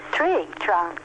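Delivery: ordinary delivery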